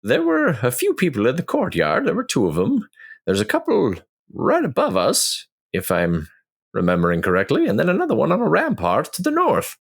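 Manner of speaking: high-pitched adventurer voice; high, put-on figure reporting back; Ornan in character. Plays Ornan answering the party.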